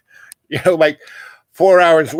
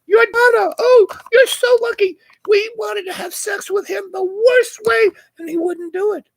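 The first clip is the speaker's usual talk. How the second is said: high mock girly voice; gushing models. Models gushing to the wife about him, in a high mock squeal.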